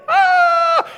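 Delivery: very high, loud mock wail; mourner's wail. Preacher picturing mourners as Jesus walks in, screaming wail. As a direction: shriek like a professional mourner. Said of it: "wailing is screaming".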